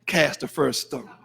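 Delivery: lightly imitating a self-justifying churchgoer; mocking excuse-maker. Preacher mocking how believers excuse sin, low and soft. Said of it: "you know how we say it?".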